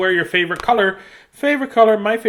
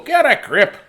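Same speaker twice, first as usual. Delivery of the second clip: higher, louder brisk grandma order; grandma snapping; 9 semitones higher, 5 dB louder than usual. Acted. Grandma telling grumpy Grace off, loud mock scolding. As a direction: bark it like a fed-up granny. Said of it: "I like this grandma".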